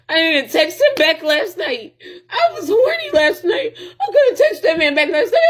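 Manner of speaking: high, half-laughing, half-crying; a cracked laugh-cry over last night; laugh-crying rant. Real, not acted. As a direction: laugh through the frustration. Ranting about him, laughing and crying.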